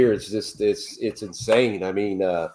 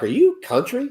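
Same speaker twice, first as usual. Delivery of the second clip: higher, imitating a curious lawyer; lawyer's question; 10 semitones higher than usual. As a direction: lightly voice her surprised question. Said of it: "she's like".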